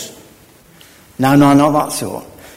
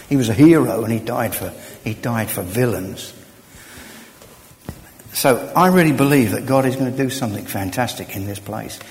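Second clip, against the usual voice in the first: ordinary talk, nothing marked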